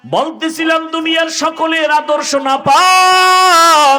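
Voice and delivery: high, loud chanting sermon delivery; full-force preaching. Real, not acted. Preacher rousing youth about the Prophet's example, high full-force chant. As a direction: preach it high, stretching the last words.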